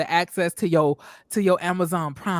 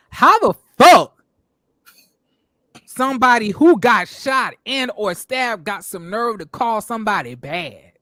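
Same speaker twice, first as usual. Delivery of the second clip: put-on loud DC accent taunt; mock DC accent; 3 semitones higher, 8 dB louder than usual. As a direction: throw the DC accent at him like a dare. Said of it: "I can hear this shit in his accent".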